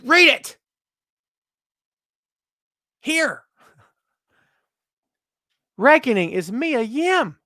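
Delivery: high-pitched, incredulous hype; mock astonishment. Host hammering home a big reveal, mock astonishment. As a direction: pitch it way up as if stunned.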